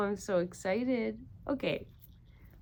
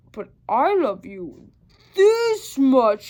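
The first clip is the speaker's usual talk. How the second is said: louder, fond and firm; big hare voice; 4 semitones higher, 12 dB louder, slower than usual. Acted. Big hare answers little hare with a bigger love. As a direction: answer with gentle pride. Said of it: "he said".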